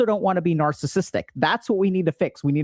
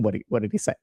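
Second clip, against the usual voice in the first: low, softer echo of the question; puzzled echo; 6 semitones lower, 3 dB quieter, faster than usual. Retold or imitated. Husband echoing his wife's question back to her, puzzled.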